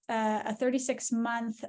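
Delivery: ordinary talk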